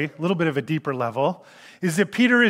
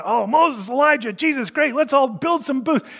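higher, bright rush of a flustered disciple; eager Peter; 8 semitones higher than usual. Retold or imitated. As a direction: gush like Peter with a plan. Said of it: "So here's Peter trying".

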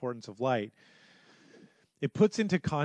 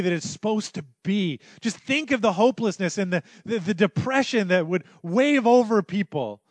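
builds from plain to high, loud preaching; rising pulpit crescendo; 6 semitones higher, 9 dB louder than usual. Acted. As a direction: climb with each question until you are nearly shouting. Rising rhetorical questions.

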